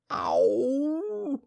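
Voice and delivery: long, high "ow"; drawn-out high howl, head stuck in a jar; high storybook yowl of woe; wail from inside a jar. Acted. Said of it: "he said inside the jar".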